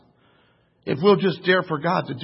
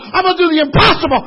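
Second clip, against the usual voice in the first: faster repeat; 10 semitones higher, 10 dB louder, faster than usual. Acted.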